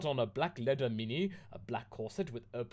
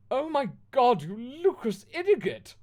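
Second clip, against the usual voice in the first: much higher and louder mock fury; hammed-up outraged shriek; shouting angrily; 12 semitones higher, 11 dB louder than usual. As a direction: caught couple yelling at the narrator. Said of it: "they both shouted angrily".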